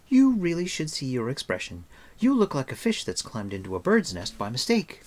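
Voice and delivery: put-on amused, chattery girl; teasing girl. A narrator's reading. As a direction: tease him in a high, lilting voice.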